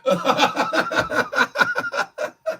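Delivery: high, wheezing real laugh; helpless laughing fit, long and high; hard laughter. Real, not acted. Streamer in tears at what viewers say, hard laughter. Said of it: "I laughed so hard just then".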